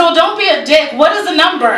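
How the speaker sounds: high-pitched exasperated demand; mock impatience. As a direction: press him for an answer, comically fed up. Retold impatient demand to financial guy.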